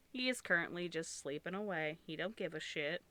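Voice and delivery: normal talking voice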